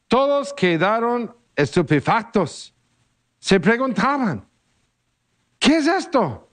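measured liturgical reading voice; gospel reading. Acted. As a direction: proclaim the Gospel steadily to the congregation. Reads the gospel at mass. (lectura solemne del Evangelio)

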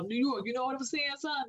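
put-on hammy New York accent; mock Queens swagger. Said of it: "That was real corny".

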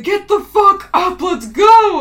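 loud, high, drawn-out imitated shout; frantic retold yell. T C urging collapsed Sasha on, frantic acted yell. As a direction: yell it as someone losing it. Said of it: "T C's losing his mind".

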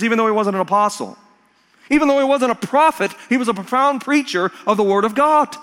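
high, ringing pulpit climax; forceful preaching. Real, not acted. Preacher proclaiming Stephen's legacy to church, high forceful preaching. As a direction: proclaim it with punched key names.

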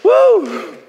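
high excited whoop, real; preacher's ecstatic whoop; joyful high whoop. Real, not acted. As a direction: let out a whoop of praise. Preacher's joyful outburst after laughing.

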